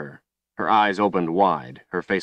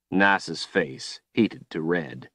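ordinary delivery

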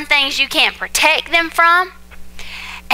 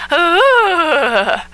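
imitated baby cry; 4 semitones higher than usual. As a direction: mimic the baby's gas-pain whimper. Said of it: "this cry that he does sounds like this".

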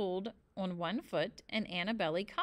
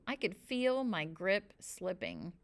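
ordinary delivery

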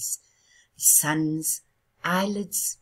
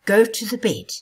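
loud, higher stern command; stern order; 5 semitones higher, 8 dB louder than usual. Acted. Moon sternly ordering the sun to bed, loud. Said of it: "She urged the sun more sternly".

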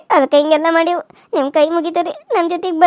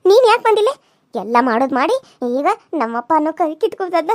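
normal talking voice

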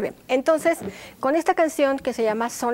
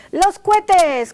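higher, louder called cue; 7 semitones higher, 11 dB louder than usual. Shown as a demonstration. Teacher calls out the cue for the rockets, loud and high. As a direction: call the cue out to the class. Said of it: "cuando yo les diga".

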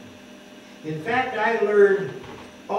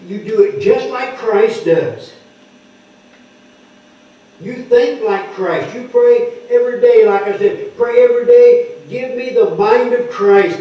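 loud, insistent preaching that climbs to near shouts; preacher urging the congregation to have the mind of Christ, loud and fervent; preaching at full force; 4 semitones higher, 8 dB louder than usual. Acted. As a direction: exhort the church to pray every day. Preaching to pray every day.

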